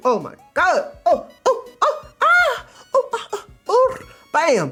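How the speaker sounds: very high, loud squeals of mock delight; hammed-up ecstatic reaction. Streamer reacting to a manga panel, squealing in overblown excitement.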